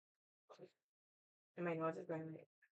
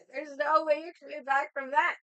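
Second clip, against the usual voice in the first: higher and louder, acted-out protest; emphatic disbelief; 8 semitones higher, 7 dB louder than usual. Texting a Braves fan about the score, emphatic disbelief. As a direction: tell them it's hopeless, loudly. Acting out texted taunt to friend.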